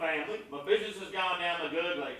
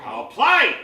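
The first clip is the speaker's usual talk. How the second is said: much louder insistence; loud defiant reply; 4 semitones higher, 11 dB louder than usual. Acted. Captain insisting to the board he'll play, loud and stubborn. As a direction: bark it stubbornly.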